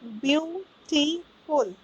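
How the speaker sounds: ब्यूटीफुल in three slow, separate beats; slow, segmented ब्यूटीफुल; syllable by syllable. Shown as a demonstration. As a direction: sound out ब्यूटीफुल as ब्यू, टी, फुल. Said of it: "दो से ज़्यादा syllables हो गए".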